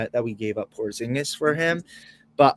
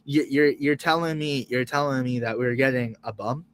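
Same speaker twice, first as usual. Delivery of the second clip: normal talking voice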